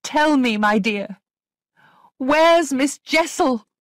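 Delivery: high, loud, pressing question; urgent questioning. A narrator's reading. Governess confronting a child about Miss Jessel, urgent questioning.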